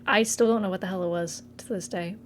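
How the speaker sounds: ordinary delivery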